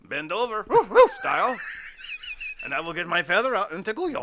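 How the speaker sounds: loud, high mock French accent. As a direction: play the saucy Frenchman, heavy accent. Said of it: "my accent changes".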